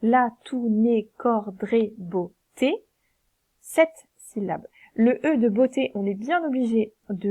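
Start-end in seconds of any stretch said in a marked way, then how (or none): 0.0-2.7 slowly, each syllable marked; slow scansion. Shown as a demonstration. Teacher models the scansion for pupils, slowly. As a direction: scan the verse out slowly. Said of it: "on va faire la liaison".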